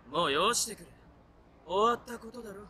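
normal talking voice

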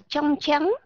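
"trong trắng" in a Northern accent, tr said as ch, slow; giọng miền Bắc; Northern Vietnamese accent. Shown as a demonstration. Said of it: "người miền Bắc đọc là chờ".

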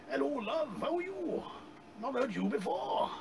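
high, soft mock Welsh bloke; hammed-up Welsh chat-up voice; Welsh accent. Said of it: "that was supposed to be a Welsh accent".

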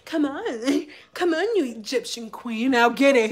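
put-on soft, coaxing old man; a hint of a creepy coaxing man.

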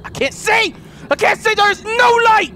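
high frantic cries in the dark; scared gamer shouting he can't see; panicked yelling. Real, not acted. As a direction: yell it out in a real panic. Panicking in dark horror game.

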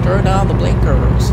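a deliberately terrible American drawl; bad American accent. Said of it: "That is the worst impression of an American ever".

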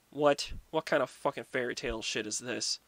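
usual speaking voice

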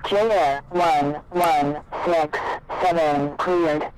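ordinary delivery

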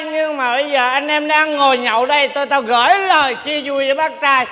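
normal talking voice

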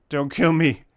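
loud half-joking plea for mercy; mock begging, punched loud; playful plea. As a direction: call out to the enemy, loudly. Pleading with enemy in game.

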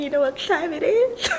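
words breaking into a high laugh. Real, not acted. Laughing at her own joke.

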